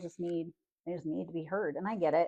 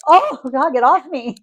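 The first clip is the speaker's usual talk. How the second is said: very loud, high mock cry; imitated revolted outburst; 9 semitones higher, 14 dB louder, slower than usual. Husband swamped by others' feelings, mock panicked. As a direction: cry out as if shaking something off.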